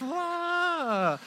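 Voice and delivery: mock cry of a hurt kid, pitched high; imitated injured wail; high pained cry. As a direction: wail like a dying squirrel.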